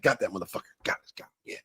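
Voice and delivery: hushed, breathy triumph over a fly; muttered whisper. Real, not acted. Vlogger swats a fly mid-review, whispering. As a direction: whisper it while you nail the bug.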